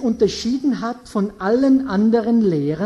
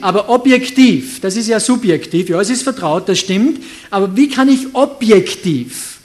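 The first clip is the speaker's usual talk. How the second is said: louder, emphatic preaching to the congregation; forceful preaching; 7 dB louder than usual. Acted. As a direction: press the point home like a pulpit preacher. Preacher drives home his point.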